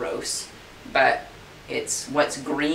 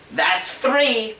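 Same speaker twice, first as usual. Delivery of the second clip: louder, higher stern count; stern warning; 7 semitones higher, 3 dB louder than usual. Acted. Groom counting at the stubborn horse, stern and loud. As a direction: warn the horse sharply.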